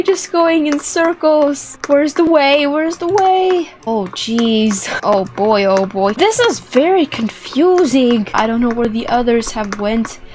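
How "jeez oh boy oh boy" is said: usual speaking voice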